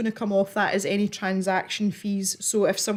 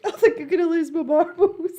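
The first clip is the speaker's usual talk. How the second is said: laughing; 6 semitones higher than usual. Real, not acted. Laughing fit during charity stream.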